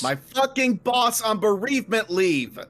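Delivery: loud, slow outraged reveal; pissed off. Real, not acted. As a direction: pitch it high and hammer it out slowly. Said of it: "I'm pissed".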